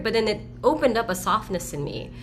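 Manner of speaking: normal talking voice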